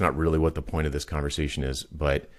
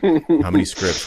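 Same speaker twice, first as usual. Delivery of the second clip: excited build-up; 10 semitones higher, 7 dB louder, slower than usual. Retold or imitated. Listing build details excitedly.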